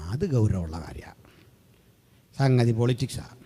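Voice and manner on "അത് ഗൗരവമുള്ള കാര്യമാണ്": whisper. Acted. Dramatic aside about serious matter.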